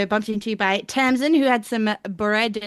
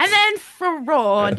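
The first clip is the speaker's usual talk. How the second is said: mock dramatic; 4 semitones higher, 4 dB louder, much slower than usual. Real, not acted. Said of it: "I wrote fraud".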